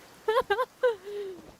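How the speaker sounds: loud squealing laugh; high ecstatic laugh. Real, not acted. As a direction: laugh out in pure joy. Overjoyed at character.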